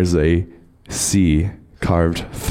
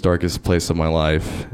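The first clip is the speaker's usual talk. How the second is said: ordinary talk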